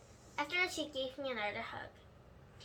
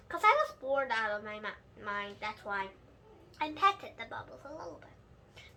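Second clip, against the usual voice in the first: ordinary delivery, nothing marked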